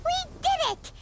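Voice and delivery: high, squeaky cartoon excitement; childlike, high-pitched joyful exclaiming; triumphant cheer. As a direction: celebrate like a tiny delighted mascot. Celebrating a success in game story.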